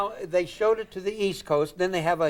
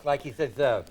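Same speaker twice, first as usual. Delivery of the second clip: ordinary talk, nothing marked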